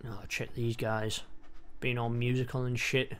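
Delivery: ordinary delivery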